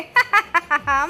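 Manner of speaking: mischievous burst of ha-ha laughter; gleeful laugh. Acted. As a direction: laugh at the prank you just pulled. Gleeful at the prank.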